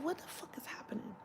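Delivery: hushed, voiceless disbelief; whispered aside. Retold or imitated. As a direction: whisper it to yourself. Voicing private reaction under breath.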